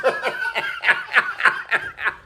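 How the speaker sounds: shrieking high laughter. Real, not acted. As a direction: squeal with laughter. Laughing at own joke.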